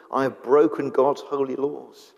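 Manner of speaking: ordinary talk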